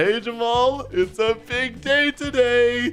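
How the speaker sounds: high, drawn-out cheery hail; sing-out high excitement; excited greeting. Pretend. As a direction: greet a friend, buzzing about the day. Excited greeting on launch day.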